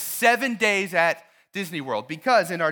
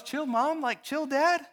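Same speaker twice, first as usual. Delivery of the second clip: put-on teen son; 6 semitones higher than usual. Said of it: "your son looks at you and goes".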